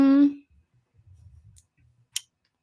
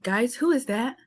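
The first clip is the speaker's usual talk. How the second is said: imitated worried streamers; 5 semitones higher than usual. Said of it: "you would just see them be like".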